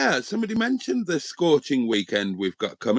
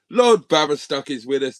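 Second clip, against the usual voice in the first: ordinary delivery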